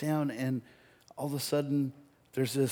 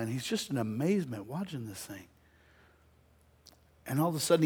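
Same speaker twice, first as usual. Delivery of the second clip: normal talking voice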